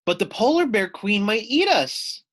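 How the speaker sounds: high, loud frightened wail. Acted. Loony Little wailing to friends in fear. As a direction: cry out in terror of being eaten. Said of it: "wailed Loony Little".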